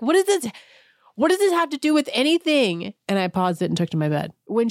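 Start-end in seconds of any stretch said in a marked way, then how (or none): 0.0-2.9 high, incredulous what-is-this; baffled disbelief. Retold or imitated. Viewers baffled by a rambling guest, high incredulous. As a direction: throw up your hands in disbelief.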